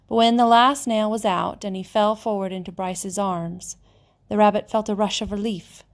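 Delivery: ordinary delivery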